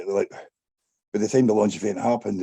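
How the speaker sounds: usual speaking voice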